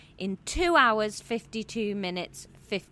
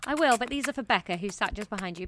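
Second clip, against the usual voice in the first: ordinary delivery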